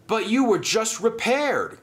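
high, startled protest; surprised gasp. Acted. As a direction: voice rises in alarm. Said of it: "gasped Flynn".

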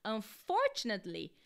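"Unfortunately" with the weight on "for"; un-FOR-tunately, clearly stressed. Shown as a demonstration. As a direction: hit the second syllable of "Unfortunately". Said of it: "você acentua o for".

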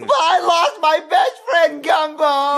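slow, whiny wail; crying. Acted. Boy told to stop crying over lost friend, crying. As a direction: protest through tears. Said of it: "stop crying".

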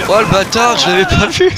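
sudden high startled cry; surprised outburst. Real, not acted. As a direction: caught off guard, voice jumping up. Surprised by unseen enemy in game.

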